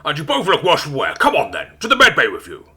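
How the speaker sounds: lightly voiced captain, brisk and firm; a hint of a ship captain ordering the crew; captain's orders. A narrator's reading. Captain sending the crew to med bay, lightly voiced firm orders. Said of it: "he orders".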